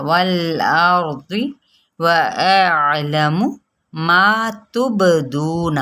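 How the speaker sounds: slow recitation with a qalqala bounce on the با; Quranic recitation. Shown as a demonstration. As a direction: recite slowly and bounce the با in تبدون. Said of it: "با پہ قلقلہ". (قلقلہ کے ساتھ آہستہ)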